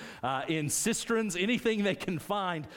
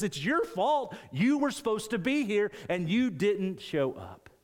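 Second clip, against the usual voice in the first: higher, pointed accusation; emphatic blame; 7 semitones higher than usual. Acted. Saul blaming Samuel for not showing up, emphatic and higher. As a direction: throw the blame back hard. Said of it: "very emphatically and says".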